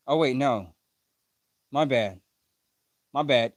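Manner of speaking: ordinary talk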